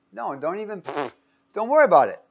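ordinary talk, nothing marked

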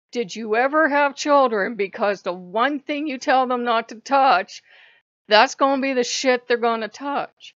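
loud, emphatic scolding; exasperated challenge. Acted. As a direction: drive each phrase home loudly. Exasperated challenge addressed to God.

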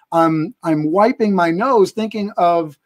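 normal talking voice

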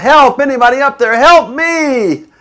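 high, loud desperate cries; panicked calls up the cliff; crying out for help. Retold or imitated. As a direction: yell for help while clinging to a branch. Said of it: "he's crying out".

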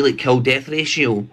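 normal talking voice

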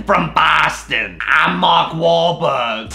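slow, lightly voiced Boston accent as Mark Wahlberg. Reading Boston mail, a slow mock Boston accent. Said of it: "Spot on Boston impression".

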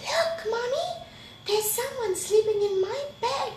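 very high little-bear voice; excited Baby Bear. A narrator's reading. Baby Bear calling Mommy to the bed, high-pitched alarm. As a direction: squeak it up high like a little cub.

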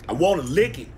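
usual speaking voice

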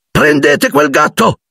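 high, drawn-out urgent cry; yelling. A narrator's reading. Ron to the room as the cat escapes, yelling. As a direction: stretch out a high-pitched yelled order. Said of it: "urlò Ron".

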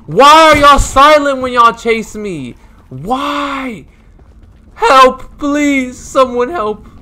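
high, loud, slowed frantic plea for help; begging for rescue while being chased; panicked pleading. Real, not acted.

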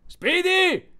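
high, carrying yell; yelled name. A narrator's reading. Powell hailing the errant robot, yelling. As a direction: call the name far out, pitched very high. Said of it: "urlò Powell".

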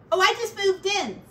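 high, loud chatty rat voice; Ratty greeting his new neighbor; cheery pack rat. Acted. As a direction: chirp it out proudly.

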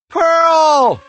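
long, high, loud name call; desperate drawn-out shout; yelling. A narrator's reading. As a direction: yell after someone being carried off. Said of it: "Michael yelled".

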